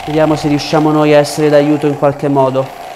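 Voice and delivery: usual speaking voice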